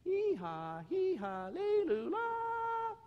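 loud, high donkey bray breaking upward; bellowed bray. Acted. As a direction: bray as loud as you can for the crowd. [name] brays again.

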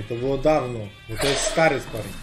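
usual speaking voice

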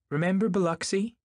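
high, pointed warning question. A narrator's reading. Jordan reminding her about an old acquaintance, warning. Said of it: "Jordan warned her".

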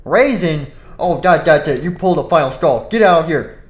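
loud furious outburst; furious boss. Acted. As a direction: explode at the news.

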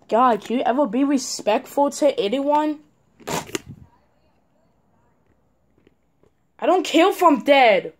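real shouted rage at a disrespectful teammate; angry yell. Real, not acted. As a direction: blast him for his disrespect.

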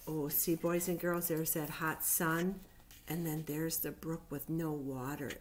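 ordinary delivery, nothing marked

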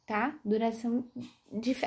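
usual speaking voice

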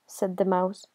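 usual speaking voice

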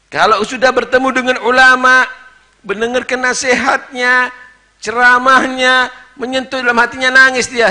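loud high fervent sermon; impassioned preaching. Acted. Preacher telling of Imam Ghazali's father weeping, at full force.

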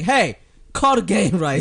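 a hint of a stern teacher; stern McGonagall. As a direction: snap at the announcer. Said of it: "McGonagall's like".

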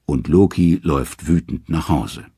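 ordinary delivery, nothing marked

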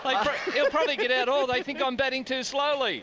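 giggling through the words; words broken by laughter; laughing while talking. Real, not acted.

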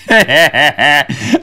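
amused chuckling after a story; real laugh. Real, not acted. Storyteller remembering the hilarious prank, real laughing. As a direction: laugh at your own tale.

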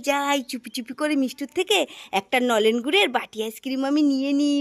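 ordinary talk

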